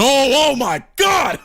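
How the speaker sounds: a real burst of excitement; excited shout. Real, not acted. To the audience, realizing she texted him: excited shout.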